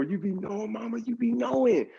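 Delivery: high-pitched buttering-up of mama; playful flattery. Retold or imitated. Caught son flatters his mother, exaggerated high admiration. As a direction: gush in a high, mock-admiring voice.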